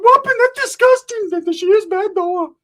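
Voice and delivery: very high, loud squeaky voice; Ricky's squeaky voice. Retold or imitated. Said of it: "Ricky's like".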